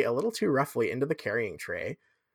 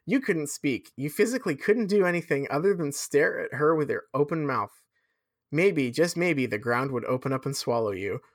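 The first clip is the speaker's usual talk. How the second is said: usual speaking voice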